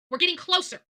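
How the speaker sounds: ordinary delivery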